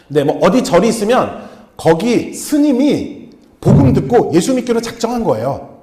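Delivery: usual speaking voice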